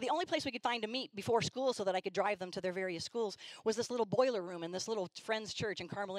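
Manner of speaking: ordinary talk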